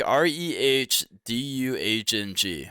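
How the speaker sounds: slow letter-by-letter spelling; spelled out slowly. Shown as a demonstration. Reading out how it sounds, spelled slowly. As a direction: spell it out one letter at a time. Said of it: "Sounds like".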